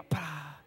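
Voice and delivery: low, soft "pa" sound effect; imitated punch. Triplet demonstrating a punch to him, imitated blow. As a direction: puff out the impact, low and quiet.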